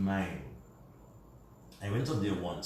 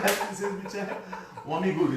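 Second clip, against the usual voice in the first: mock Vincy accent; 10 semitones higher, 4 dB louder than usual. Father quoted in Vincy accent.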